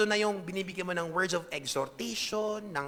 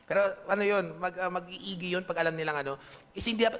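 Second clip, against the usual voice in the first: ordinary talk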